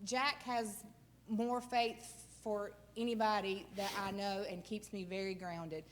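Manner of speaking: ordinary talk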